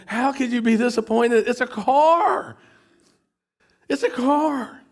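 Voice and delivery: can't believe she's disappointed in a car; exasperated disbelief. Retold or imitated. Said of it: "I'm sitting there thinking".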